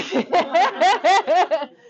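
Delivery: high, loud, rolling laugh; real loud cackling laughter; hearty laugh. Real, not acted. Teacher laughing with the class over the book, loudly. Laughs after joking exchange.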